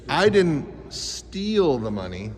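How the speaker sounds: slow, with "steal" set apart and drawn out; stress on STEAL. Shown as a demonstration. Teacher showing kids voice inflection, stress on STEAL. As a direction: pause before "steal" and lean on it. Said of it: "Voice inflection is like this".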